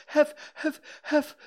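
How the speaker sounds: high, panting stutter; Piglet out of breath. A narrator's reading. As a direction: pant out broken syllables in panic. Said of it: "breathing so hard he could hardly speak".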